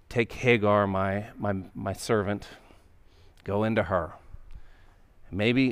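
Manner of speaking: hushed night suggestion. Retold or imitated. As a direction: lean in and murmur it, unhurried. Sarah's private night suggestion.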